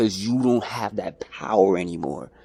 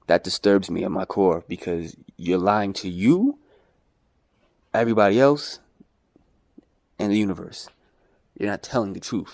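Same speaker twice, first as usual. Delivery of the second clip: ordinary talk, nothing marked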